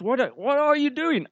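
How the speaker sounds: normal talking voice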